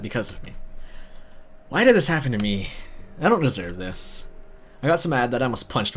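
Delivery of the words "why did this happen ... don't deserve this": usual speaking voice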